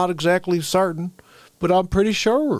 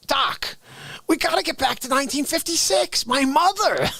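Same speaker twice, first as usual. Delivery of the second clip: a hint of panicked Marty, higher; half-working Marty, urgent and higher; Marty McFly; 6 semitones higher than usual. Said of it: "I can't do him".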